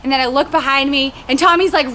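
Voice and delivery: ordinary talk, nothing marked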